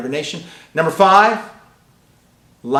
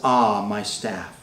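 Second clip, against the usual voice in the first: much lower, very slow smug cat voice; 7 semitones lower, 4 dB quieter, much slower than usual. Retold or imitated. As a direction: drawl it like a cat lording it over servants. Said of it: "The cat says".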